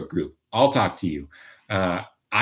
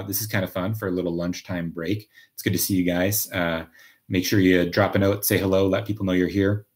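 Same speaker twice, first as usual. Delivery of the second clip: usual speaking voice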